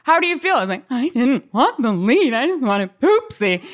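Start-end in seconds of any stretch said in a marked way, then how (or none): 0.0-0.5 high-pitched, gushing mock congratulations; excited band director. Band director rushes over to student cast as the lead, gushing. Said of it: "thought I was so overwhelmed with just, like, how excited I was". 0.9-3.6 higher, drawn-out tearful wail, hammed up; mock sobbing. Sobbing girl answers the band director, wailing in mock misery. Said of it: "And I start sobbing".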